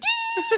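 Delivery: sudden very high yelp, laughing; flinching squeal. Retold or imitated. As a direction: squeal as if a thumb is touched. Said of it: "It's like".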